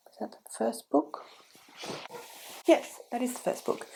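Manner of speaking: whispering a quick check to herself; whispered aside. Real, not acted. Booktuber checks under her breath which book comes first, whispering.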